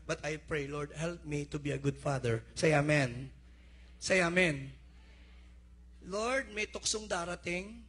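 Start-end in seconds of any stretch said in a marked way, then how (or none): 2.5-3.3 loud call of "Say amen"; rally the church to answer. Real, not acted. Pastor calling church to respond. 4.0-4.7 loud "Say amen" with falling end; amen call again. Real, not acted. Pastor calling church to respond.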